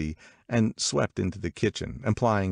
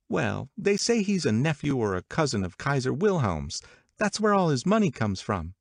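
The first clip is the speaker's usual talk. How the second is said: high, lively gossiping woman; gossipy Catherine; 8 semitones higher than usual. Acted. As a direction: pitch it up like eager party gossip. Voices Catherine's party gossip.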